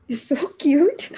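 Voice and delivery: high giggly delight; giggling. Acted. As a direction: laugh through the words, fondly. Giggling fondly at the listener.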